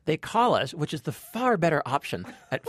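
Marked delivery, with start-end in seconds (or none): none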